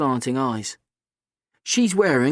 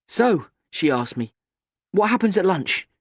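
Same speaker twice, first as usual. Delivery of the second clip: put-on higher, bright cheeky girl; 7 semitones higher than usual. A narrator's reading. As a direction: play the confident teenage girl. Kat voiced.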